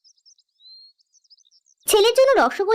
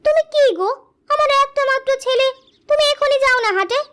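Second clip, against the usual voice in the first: high-pitched, pressing wife voice; chiding wife; 9 semitones higher than usual. Acted. Wife pushes husband to market for son, chiding. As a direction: nag your husband on the boy's behalf, pitched high.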